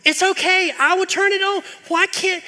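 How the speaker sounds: high, loud exasperated tirade about the dishes; exasperated rant. Acted. Preacher rants at family about dishes left out, exasperated and loud. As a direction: rant like a fed-up spouse at the sink.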